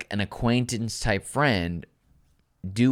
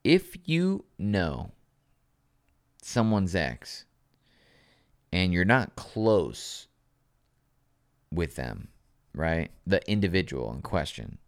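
dramatic slow; 2 semitones lower than usual. Acted.

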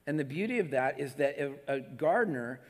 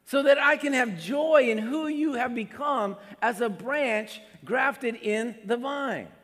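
louder, higher voice of a proud Jesus; 7 semitones higher, 6 dB louder than usual. Pretend. Preacher voicing a proud Jesus. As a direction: beam with pride over the branch. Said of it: "this is Jesus going".

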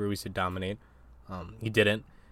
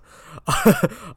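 sudden squeaky laugh, much higher; high burst of laughter; 9 dB louder than usual. Real, not acted. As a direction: break into a high, loud giggle. Laughing during the topic switch.

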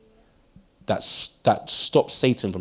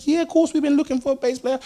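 high, bright-eyed eager yes; eager welcome. Retold or imitated. Church members eagerly welcoming the new bass player, high and excited. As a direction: play the delighted church crowd.

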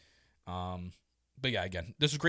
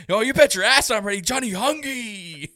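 loud, high hyped-up wrestler catchphrase; Dark Order hype; 10 semitones higher, 9 dB louder than usual. Retold or imitated. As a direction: burst out shouting, then stretch the name. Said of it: "you see the Dark Order, like".

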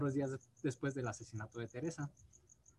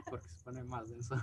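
stifled giggles; 4 dB quieter than usual. Real, not acted. No te rías.

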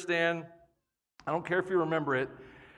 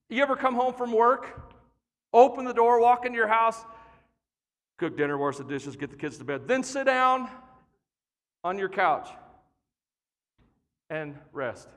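ordinary talk

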